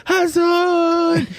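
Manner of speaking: high, drawn-out call of "Hassan!"; mocking call of the name. Friends mockingly calling his name.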